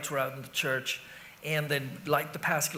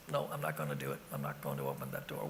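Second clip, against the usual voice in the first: very low, soft grumble to oneself; muttered refusal; 10 dB quieter, faster than usual. Retold or imitated. Man alone refusing to answer his door, low soft mutter. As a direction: drop right down and quietly refuse. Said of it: "I remember going".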